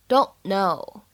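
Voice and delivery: "don't know" with the t in "don't" left unreleased; unreleased stop. Shown as a demonstration. Teacher shows learners "don't know" with an unreleased t. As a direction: run "don't" into "know" without releasing the t. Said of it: "不完全爆破的现象".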